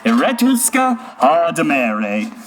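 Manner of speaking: slow, drawn-out nonsense puppet line. Acted. Puppet answering a little kid, slow puppet voice. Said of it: "It comes from a puppet voice".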